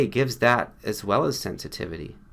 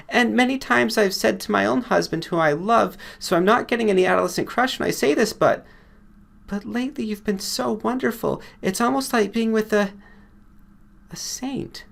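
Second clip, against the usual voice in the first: higher woman's voice, rushing then hushed at the end; gushing female patient; 12 semitones higher than usual. Acted. Patient confesses her adoration to the doctor, higher, rushing then breathless. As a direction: tumble out the praise, whisper the last word.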